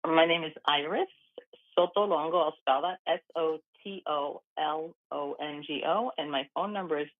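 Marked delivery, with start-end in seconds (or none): 3.1-6.1 slow, letter by letter; measured radio spelling with each letter separate. Real, not acted. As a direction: spell it out slowly for listeners. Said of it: "we say it slowly".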